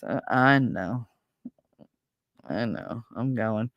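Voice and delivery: low, soft, resigned grumble; mock reluctant. Daughter answering mother about Carlos downstairs, mildly resigned.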